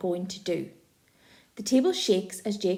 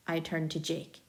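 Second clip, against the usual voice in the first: ordinary delivery, nothing marked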